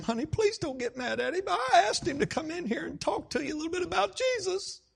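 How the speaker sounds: high, urgent imitated plea; anxious pleading. Wife introducing pastor to hostile husband, anxious pleading. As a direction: hurry to placate, voice climbing tearfully.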